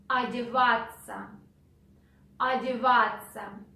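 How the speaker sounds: ordinary talk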